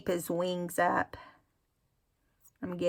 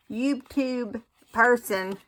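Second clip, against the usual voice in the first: YouTube person laughed out slowly; laughing; 5 semitones higher, slower than usual. Real, not acted. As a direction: chuckle through YouTube person.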